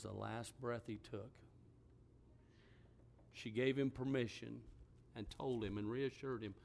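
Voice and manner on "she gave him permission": usual speaking voice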